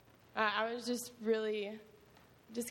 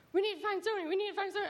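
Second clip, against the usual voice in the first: imitating the kids' urgent high voices; urgent kids; 10 semitones higher, much faster than usual. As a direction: pitch it up and press the repeat. Kids panicking about another crying boy.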